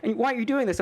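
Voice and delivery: high whiny kid voice, mock hurt; whiny child. Mock child whines at his father. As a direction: play the whining kid complaining to father. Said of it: "like, like".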